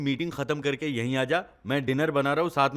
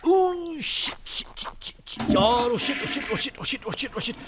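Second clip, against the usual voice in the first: high, gasped cursing on repeat; panic, repeating it in breathy bursts; panicked; 11 semitones higher, slower than usual. Acted. Man remembers milk left on the stove, panicked.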